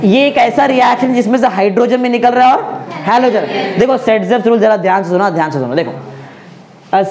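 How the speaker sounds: ordinary delivery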